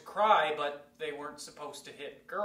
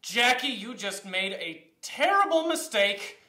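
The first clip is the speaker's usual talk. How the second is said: retold dawning alarm, higher and louder; alarmed inner voice; 7 semitones higher, 6 dB louder than usual. Boy to himself after hitting the girl back, alarmed. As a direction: think it aloud, pitched up and emphatic, in retold dread.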